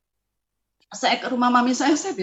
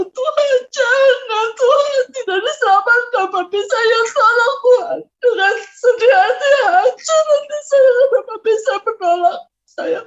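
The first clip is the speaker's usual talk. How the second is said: loud, high-pitched sobbing plea; heartbroken crying; 11 semitones higher, 5 dB louder than usual. Real, not acted. Praying for the father who rejected them, sobbing. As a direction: wail it out, voice breaking with tears. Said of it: "dengan hancur hati".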